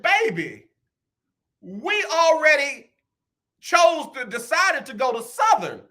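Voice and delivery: put-on high, drawn-out emotional announcement to his child; hammed-up tearful declaration. Father telling his daughter their college choice, hammed-up tearful declaration. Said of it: "I said to her in these words with tears in my eyes".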